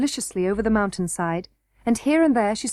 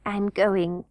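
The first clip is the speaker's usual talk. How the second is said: sleepy. A narrator's reading. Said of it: "said Harelip sleepily".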